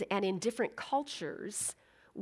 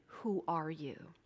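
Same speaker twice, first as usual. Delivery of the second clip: soft solemn question; 6 semitones lower, 12 dB quieter, slower than usual. Shown as a demonstration. Deep question softly.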